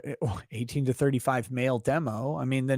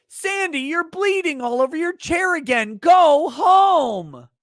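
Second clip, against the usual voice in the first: high-pitched holler, building louder to the end; mock exasperated yell; 13 semitones higher, 8 dB louder, slower than usual. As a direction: yell it across the office in mock outrage. Acted boss yelling at a co-worker.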